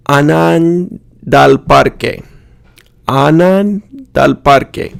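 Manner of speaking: Ana anda al parque fused as anandal parque; linked vowels. Shown as a demonstration. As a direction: run Ana anda al parque together, shared a said once. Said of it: "vowel is pronounced only once".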